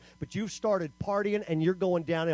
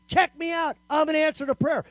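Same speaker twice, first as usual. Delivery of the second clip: put-on higher, cocky teen boast; proud boast; 7 semitones higher, 4 dB louder than usual. Teens boasting about serving.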